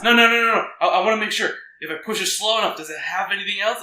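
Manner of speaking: high, loud, frantic rush of no's; hammed-up frantic client; frantic refusal. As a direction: protest in a mock panic, loud and high. Acted panicked client refusing.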